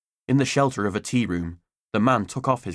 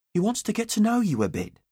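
higher, lightly coaxing mum; breezy mother; 12 semitones higher than usual. A narrator's reading. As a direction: brush him off sweetly as mum. Mother voiced.

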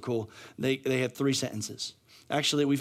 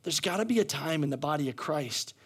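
ordinary talk

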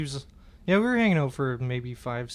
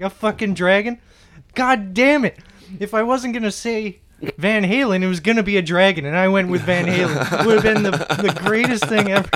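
loud, higher mock exasperated rant; hammed-up outburst; 6 semitones higher, 9 dB louder than usual. Comedian reacts to the tattoo reveal, loud hammed-up outburst. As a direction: exclaim it as if it's too perfect to bear.

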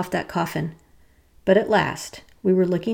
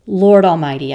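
gasped; 9 dB louder than usual. Acted. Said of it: "I gasped".

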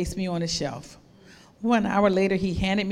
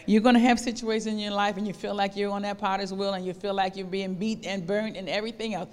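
ordinary talk, nothing marked

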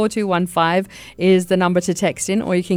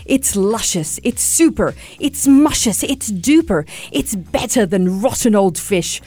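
greedy crocodile building higher and gleeful; gloating crocodile; 6 semitones higher than usual. Acted. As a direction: savour every word like a greedy beast. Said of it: "cried the crocodile".

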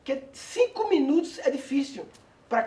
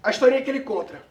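ordinary delivery

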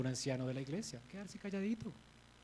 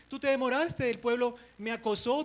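Aaron excusing; 6 semitones higher, 6 dB louder than usual. Retold or imitated. Aaron blaming Moses, voiced.

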